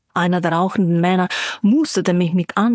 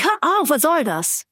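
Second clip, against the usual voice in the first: calling out; 7 semitones higher than usual. A narrator's reading. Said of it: "rief ich".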